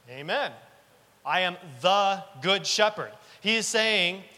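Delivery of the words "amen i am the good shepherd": ordinary talk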